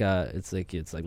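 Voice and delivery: ordinary talk, nothing marked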